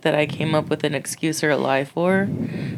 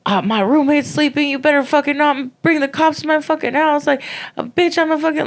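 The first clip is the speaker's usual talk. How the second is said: cussing out; 10 semitones higher than usual. Retold or imitated. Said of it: "cussing me out".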